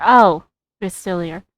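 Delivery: usual speaking voice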